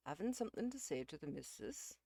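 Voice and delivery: ordinary delivery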